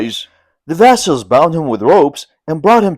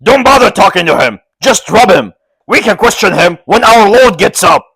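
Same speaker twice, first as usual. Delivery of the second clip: loud, raised rough voice; gruff vassal orders; 6 semitones higher, 5 dB louder than usual. A narrator's reading. Old vassal urges the others to beat Lin, loud and raised.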